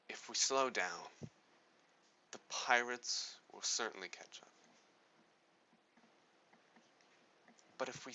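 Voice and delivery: a grave captain voicing despair about the damaged ship; dramatic delivery. Shown as a demonstration. Teacher demonstrating the dramatic mood to the audience, slow and grave with long pauses. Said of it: "this is, um, how I would talk about dramatic".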